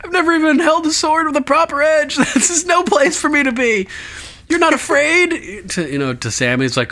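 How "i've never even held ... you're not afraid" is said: panicky Pip. Retold or imitated. Host voicing frightened Pip before battle, mock panic. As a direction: squeak it out in panic. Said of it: "And Pip's like".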